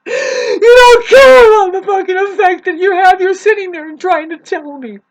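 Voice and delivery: high, loud, anguished shouting; angry rant. Real, not acted.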